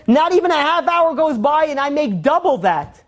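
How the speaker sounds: high, impassioned cry of amazement; overwhelmed. Retold or imitated. Crying collector marvelling to the donor, overwhelmed. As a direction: cry it out high, overcome by the windfall. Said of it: "The guy starts bawling, crying".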